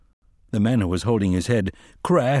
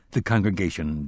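plain reading voice

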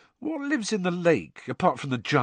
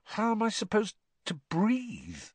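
slow, high, puzzled question; hesitant, drawn-out question; slowly; 12 semitones higher, 4 dB quieter than usual. A narrator's reading. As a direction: ask it slowly as worry dawns. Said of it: "Harry said slowly".

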